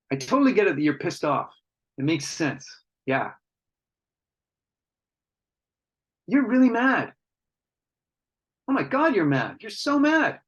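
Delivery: rising intensity, pitch climbing high; match the man's anger with building energy; matching his intensity. Shown as a demonstration. Coach to a man, matching his anger, intensity building. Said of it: "You can hear my intensity in my voice as I say it comes up a bit".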